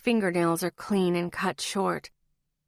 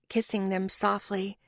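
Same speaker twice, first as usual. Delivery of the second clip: plain reading voice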